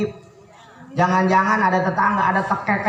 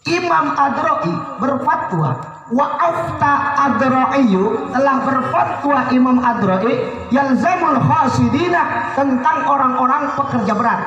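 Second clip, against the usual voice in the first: recited fatwa; 4 semitones higher than usual. Acted.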